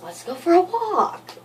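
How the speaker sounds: high, put-on voice for the boyfriend; imitating boyfriend. Boyfriend suggesting a walk, high mimicking voice. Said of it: "So then he's all like".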